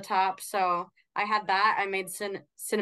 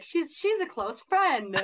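mocking; 8 semitones higher, slower than usual. Mocking Josh's introduction.

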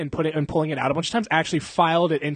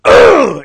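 angry grunt; 9 semitones higher, 13 dB louder than usual. Retold or imitated. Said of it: "'cause I'm fucking angry".

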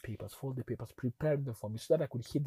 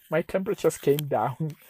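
ordinary talk, nothing marked

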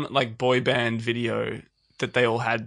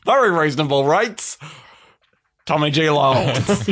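mock ad announcer. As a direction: pitch it like a cheesy commercial. Mock loan advert.

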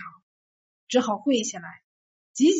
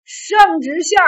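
a sharp shouted proclamation; loud proclamation; 5 semitones higher, 8 dB louder than usual. A narrator's reading. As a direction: bellow it out like a herald. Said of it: "在屋里大喝一声".

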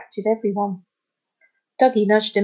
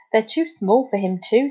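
ordinary delivery, nothing marked

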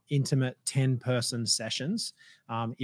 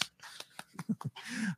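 laugh; 10 semitones higher, 9 dB quieter than usual. Real, not acted. Host laughs at guest's compliment.

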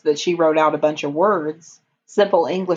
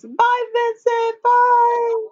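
high, drawn-out mock farewell call; imitated boy's goodbye; much slower than usual. Boy bidding his dog goodbye from the raft, high mock wail. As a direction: call a long high goodbye in a child's role.

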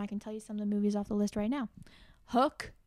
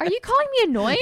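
mock outrage; 11 semitones higher, 9 dB louder, faster than usual. Mock outrage at boyfriend's remark.

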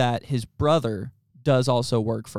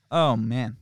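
normal talking voice

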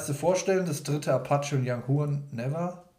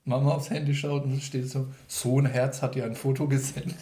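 normal talking voice

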